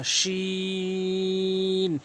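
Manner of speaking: শিন held very long and slow; drawn-out shin. Shown as a demonstration. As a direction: stretch the শিন hiss out slowly. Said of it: "শিনের মাথা". (টেনে শিন উচ্চারণ)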